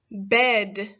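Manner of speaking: said on its own, slow and clear; clear test word. Shown as a demonstration. Teacher saying a test word for children to listen to, clearly. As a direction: present the word for careful listening. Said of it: "You have to do your best to listen".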